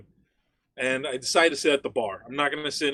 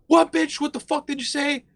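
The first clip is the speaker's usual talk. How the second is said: high, loud confrontational stripper; hammed-up outraged yell; mock angry challenge; 12 semitones higher, 5 dB louder than usual. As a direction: pick a fight at a high pitch. Acts out stripper starting a fight.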